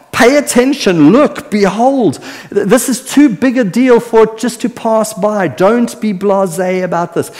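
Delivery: high, slowed, urgent calling out to friends; John, eager and excited. Pretend. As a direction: grab the listeners' attention like an excited friend. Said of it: "John would be saying to us".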